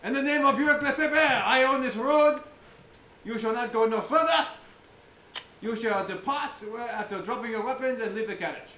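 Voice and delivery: hammed-up challenge on the road; theatrical highwayman. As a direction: halt travelers like a pompous swashbuckler. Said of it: "he puts his hand up".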